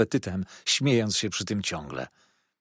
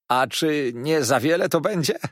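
amused; 5 semitones higher, 5 dB louder than usual. A narrator's reading. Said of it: "roześmiał się".

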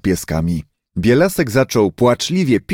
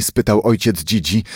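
ordinary delivery, nothing marked

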